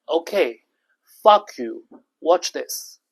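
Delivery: loud, punchy retold defiance; defiant retort. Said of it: "when I was like".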